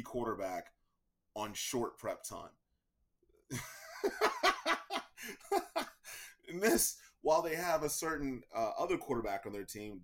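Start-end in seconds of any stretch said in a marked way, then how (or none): 3.5-6.5 high burst of laughter. Real, not acted. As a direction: let a big high laugh roll out. Laughs at Texans' situation.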